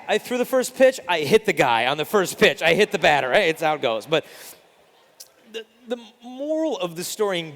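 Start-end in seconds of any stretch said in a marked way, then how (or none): none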